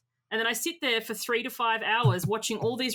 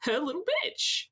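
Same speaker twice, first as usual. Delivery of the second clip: hurt disbelief; 6 semitones higher than usual. Retold or imitated. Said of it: "I felt like".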